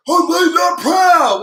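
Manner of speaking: mock man's shout, pushed loud and high; imitated deep-voiced guy. Host imitating a viewer heard screaming, mock deep-voiced shout. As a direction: lightly put on a meaty guy's holler. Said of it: "with a little deep voice".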